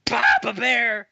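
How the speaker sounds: high drawn-out greeting; excited call. Real, not acted. Greeting friend joining the call.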